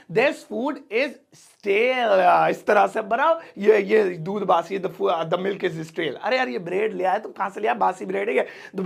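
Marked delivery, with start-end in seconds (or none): 0.1-2.5 stretched out word by word; very slow model sentence. Shown as a demonstration. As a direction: dictate it slowly for the class. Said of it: "slow repeated model sentence".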